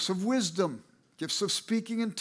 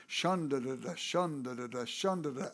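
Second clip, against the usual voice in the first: lightly imitated, low chanted tongues syllables; low murmured babble of tongues; imitating tongues prayer; 6 semitones lower, 6 dB quieter than usual. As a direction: mimic a church lady praying in tongues, softly. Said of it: "Sister Regal always prayed".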